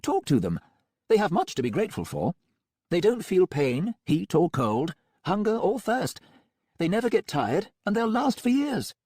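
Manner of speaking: breezy, untroubled villain doctor; Melmoth voice. A narrator's reading. Melmoth boasts.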